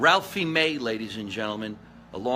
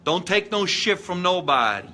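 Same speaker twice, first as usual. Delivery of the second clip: louder, higher tough-guy sign-off; catchphrase shout; 6 semitones higher, 6 dB louder than usual. Pretend. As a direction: belt out the signature closing line. How do I always end my broadcast.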